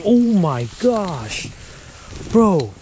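high, drawn-out cry of amazement; loud, long, stunned exclamation; freaking out. Real, not acted. Angler reacting to a giant bluegill, freaking out. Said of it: "I was, like, freaking out".

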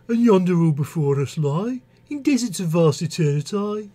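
verse in a Birmingham accent; Brummie accent. Shown as a demonstration. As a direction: read it as a Brummie would. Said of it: "apologies to all Brummie poets".

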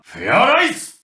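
high, rising power-up call; transformation cry. Acted. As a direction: send the word soaring upward. Transformation called out in game. (高く跳ね上げる変身の掛け声)

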